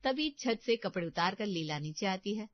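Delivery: plain reading voice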